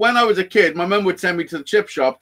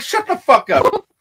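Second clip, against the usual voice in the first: mock disbelief; 9 semitones higher, slower than usual. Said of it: "I'm like".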